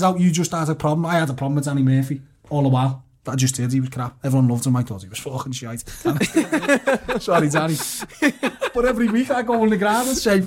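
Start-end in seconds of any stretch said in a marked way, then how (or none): 5.9-7.2 high, real laugh at himself; burst of laughter. Real, not acted. Pundit laughing at his own Danny Murphy grudge, real laugh. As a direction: laugh out at your own confession.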